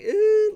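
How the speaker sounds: high, loud little imitated cry; mock whimper "ooh". Son showing what his crying was not like, high mock cry. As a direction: give a token high-pitched wail. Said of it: "it's not just like".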